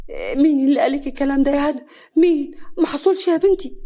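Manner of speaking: high, loud panicked denial; frightened. A narrator's reading. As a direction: deny it in rising fright. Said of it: "سعاد بخضة".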